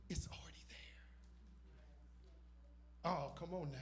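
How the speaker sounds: fast dramatic whisper. Acted. Preacher whispering a revelation to the church, hushed. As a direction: hush it like a secret.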